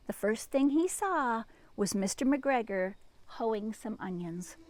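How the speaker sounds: lively storybook read-aloud; reading to children. A narrator's reading. Librarian reading to children, lively storybook voice. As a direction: read it like a picture book to kids.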